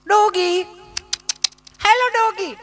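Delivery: high sing-song call to a dog; mimicking lady calling dog.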